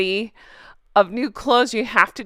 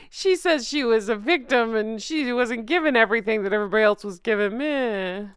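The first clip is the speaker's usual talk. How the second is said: mock complaint. Mocking retelling of her complaint.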